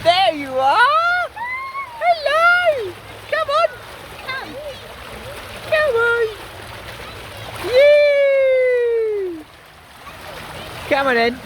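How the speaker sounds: squeaky praising a dog. Real, not acted. Cheering the dog down slide.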